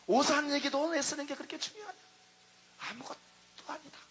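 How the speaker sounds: a gentle divine scolding that fades away; voice of the Lord. Retold or imitated. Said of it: "주님이 나에게 말씀했어요".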